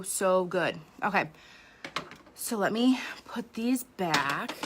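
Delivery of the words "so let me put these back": slow whispered aside; whispering. Real, not acted. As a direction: whisper it to yourself, slowly. Aside while putting bracelets back.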